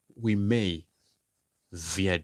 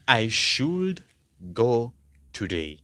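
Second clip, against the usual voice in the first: each word laid out slowly with a pause after should; slow, spaced model sentence; 3 semitones higher, 5 dB louder, slower than usual. Shown as a demonstration. Teacher modelling the English sentence to the class, slow and clear. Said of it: "You want to say".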